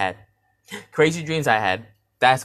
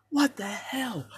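hushed; 11 semitones higher, 5 dB quieter, much slower than usual. Retold or imitated. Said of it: "I was so quiet".

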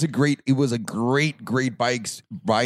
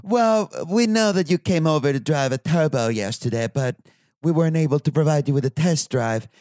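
mock British saleswoman, pitched up; butchered British accent; 4 semitones higher than usual. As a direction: badly imitate a British lady turning down a customer. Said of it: "it's a British lady".

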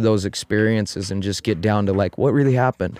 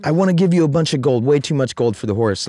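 high, excited king voice; awed king; 9 semitones higher than usual. Retold or imitated. King to farmer, high and excited over the horse.

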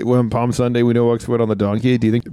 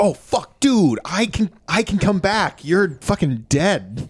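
higher-pitched exasperated complaint, slowing at the end; mock outrage, pitched up; Jesus exasperated; 7 semitones higher, slower than usual. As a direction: act fed up with a buddy. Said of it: "And Jesus is like".

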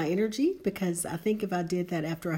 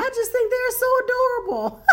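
gushing; 9 dB louder than usual. Real, not acted. Gushing over doggy wrapping paper.

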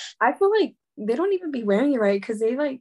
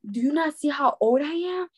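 mildly indignant customer, pitched higher; mock-offended retort in a raised pitch; retold cussing out; 6 semitones higher than usual. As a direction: imitate an affronted customer, higher but not shouting. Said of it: "cussing me out".

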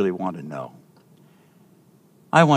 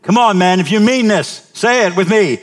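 loud, high push; loud urging; 9 semitones higher, 6 dB louder than usual. Real, not acted. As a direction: rouse them to shout. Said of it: "pastor urging the men".

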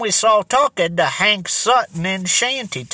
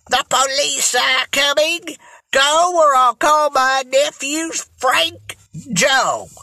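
high, frightened aunt calling down; shaky threat; 5 semitones higher than usual. A narrator's reading. Aunt warning burglars from upstairs, shaky call. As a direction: call out high, bluffing through the fear. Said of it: "in a loud but shaky voice".

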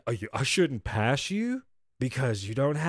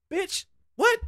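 much higher, stunned disbelief; incredulous. Retold or imitated. Reacting to the pharmacist's demand, high and stunned. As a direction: shoot it up in shock.

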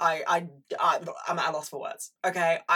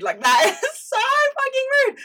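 high, louder laughing outrage, words broken by a laugh; laughing squeal; 9 dB louder than usual. Real, not acted. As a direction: squeal it through a laugh, scandalised.

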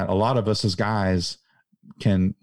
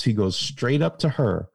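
normal talking voice

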